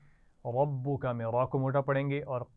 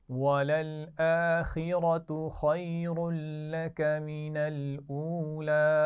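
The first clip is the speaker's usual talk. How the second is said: slow, drawn-out tajweed chant, a little higher and louder; teacher chanting Quran for students to echo; Quranic recitation; 3 semitones higher, 3 dB louder, much slower than usual. Shown as a demonstration. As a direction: model the verse slowly for the class to repeat. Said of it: "अगली आयत पढ़ते हैं".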